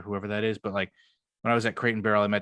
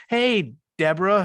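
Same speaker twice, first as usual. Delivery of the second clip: higher, louder, slower name call; mock call-out; 10 semitones higher, 7 dB louder than usual. Coworker calling a colleague by name, louder and higher. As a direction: call her name across the office.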